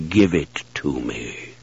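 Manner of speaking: low, slow whispered command; hushed menacing druid; mystical druid voice. Acted. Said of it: "the druid's voice thundered out of Jer".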